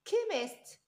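"كيميست" pitched high, opening on a hard k; k sound. Shown as a demonstration. Teacher modelling the k sound, "كيميست" starting on k. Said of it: "ك ساوند".

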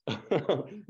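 brief real chuckle; short laugh. Real, not acted. Preacher breaking off mid-sentence before a quote, short laugh. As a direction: let a small laugh slip out.

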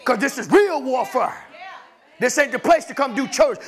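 rising preacher's fervour, a touch of laughter; preaching. Acted. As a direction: lean into the sermon. Preaching builds to full force.

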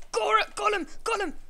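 high, loud urging; loud cheering on. Real, not acted. Gamer urging friend to attack, high excited yells. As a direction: egg your teammate on at the top of your voice.

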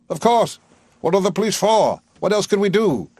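high, loud, impatient; insistent Higgins. A narrator's reading. Higgins defending calling the police, impatiently. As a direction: brush the objection aside.

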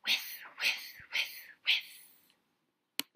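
soft breathy whiff, whiff; whispered sprinkler sound. A narrator's reading. Sprinkler sound whispered for a child listener. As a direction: whisper each whiff like spraying water. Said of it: "they go like this".

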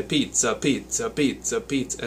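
"pizza" in a light mock Italian accent, swung and lilting; long-short swing on each "pizza", hint of Italian. Shown as a demonstration. Teacher demoing swing feel in mock Italian accent. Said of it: "If you had a thick Italian accent".

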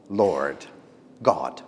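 ordinary delivery

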